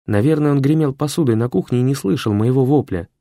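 ordinary delivery

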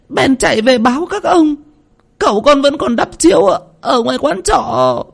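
put-on a terrified child barely holding his voice together; voice breaking. Tèo telling the family how the young master died, high and breaking with fear. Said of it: "Giọng của nó lạc đi".

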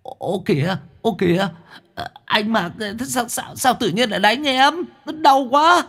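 very high, whiny yelps of pain; whining in pain. Acted. Mõm whines to Mặc while being whipped, high and pained. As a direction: wail like a beaten kid. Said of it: "rú lên đau đớn".

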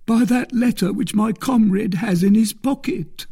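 high-pitched anxious captive; pleading bandit. A narrator's reading. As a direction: answer quickly to appease your captor. Bandit answers his captor.

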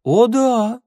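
high, loud burst of enthusiasm; gleefully emphatic confirmation; enthusiastic. A narrator's reading. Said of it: "с энтузиазмом сказал Ивар".

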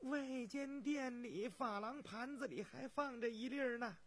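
high-pitched servant voice; volunteer it in a high voice; high, deferential attendant; palace servant piping up. Acted. Second servant speaks up.

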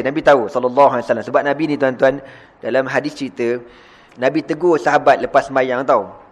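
ordinary talk, nothing marked

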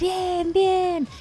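high, drawn-out mock cheer; cheering crowd. Animals hear who is left out, cheering.

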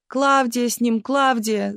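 high, plaintive call; calling back. A narrator's reading. Aunt answers Galina from the truck, calling out. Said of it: "закричала тётя Наталья".

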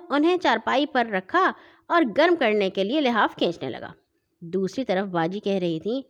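ordinary delivery, nothing marked

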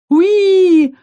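high, loud happy dragon squeal; baby dragon squeal. Acted. Little dragon Haven squeals eagerly at the heroine. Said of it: "произнёс Хейвен".

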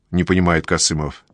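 ordinary delivery

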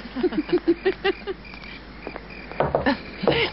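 light laugh. Real, not acted. Mother laughs at the quip.

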